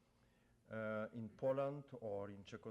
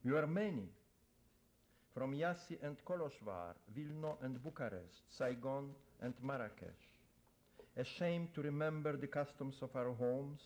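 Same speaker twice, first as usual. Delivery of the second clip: louder, measured poem-reading voice; poetry reading; 3 semitones higher, 5 dB louder than usual. Acted. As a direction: declaim the poem to the audience. Poet reads his poem aloud.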